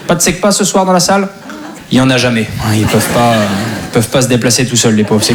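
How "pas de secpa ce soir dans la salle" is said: high, teasing question to the room; teasing crowd question. Acted. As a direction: needle the audience playfully. Asks the audience teasingly. (question moqueuse au public)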